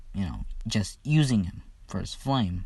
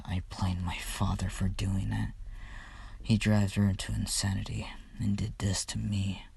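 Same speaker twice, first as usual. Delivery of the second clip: softer whispered confession; grim whisper; 2 semitones lower, 5 dB quieter than usual. Retold or imitated. Todoroki blaming his father, bitter whisper. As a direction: confide it bitterly under your breath.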